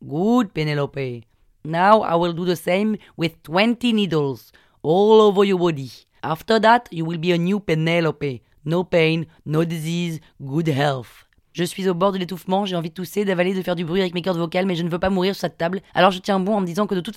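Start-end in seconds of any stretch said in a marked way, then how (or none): 0.0-11.3 slow, sing-song Indian English; Indian-accented healer, grand promise. Retold or imitated. Healer promises patient perfect health, slow Indian accent. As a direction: promise her a new life, Indian accent. Vishnu promises new Penelope.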